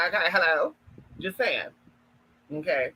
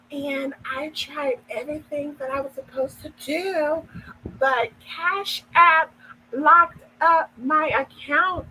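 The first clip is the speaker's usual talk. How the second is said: mock damsel accent; 6 semitones higher, slower than usual. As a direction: imitate a helpless sweet lady, slowly and high-pitched. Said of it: "this other accent where she's just like".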